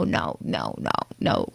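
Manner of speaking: breathless whispered pleading no. Acted. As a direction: whisper it like a scared inner voice. Panicked inner voice about stalker.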